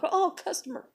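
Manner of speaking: high, light mock excuse; mocking coworkers. Mimicking coworkers who walk off, mocking high voice. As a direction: chirp it as a convenient excuse.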